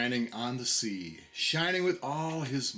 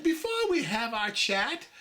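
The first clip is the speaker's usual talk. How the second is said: much higher, small anxious voice; anxious little oysters; 7 semitones higher than usual. A narrator's reading. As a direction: squeak it like a worried little oyster. The oysters cried.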